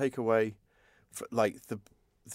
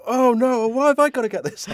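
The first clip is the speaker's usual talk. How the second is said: high, loud comic wail; mock dismay; 13 semitones higher, 10 dB louder than usual. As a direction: whine it loudly, pitched way up. Retold wail of dismayed film characters.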